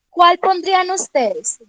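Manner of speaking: normal talking voice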